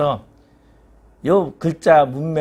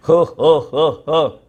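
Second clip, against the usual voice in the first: louder disbelieving huffs; God's exasperated scoffing huffs; 2 semitones higher, 5 dB louder, much slower than usual. Acted. God scoffing at Job.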